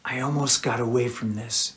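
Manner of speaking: soft, low inner voice; inner thought. A narrator's reading. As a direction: think it to yourself. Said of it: "she thought".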